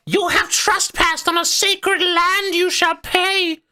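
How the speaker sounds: very high, loud, drawn-out harpy shriek; shouting harpy. Acted. Harpy threatening trespassers on sacred land, shouting. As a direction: shriek it slowly like an outraged harpy. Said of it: "one of them shouts".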